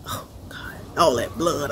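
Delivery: breathless whispered oh God; a hushed, shaken whisper; awed whisper. Retold or imitated. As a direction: whisper it as if the blood is still in front of you. Scared at the bloody birth.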